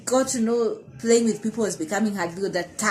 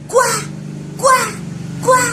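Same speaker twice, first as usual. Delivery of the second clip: loud, high-pitched mock shouts; imitated shout; 13 semitones higher, 5 dB louder, slower than usual. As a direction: shout it like the passing stranger, high and separate. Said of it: "So he shouts saying".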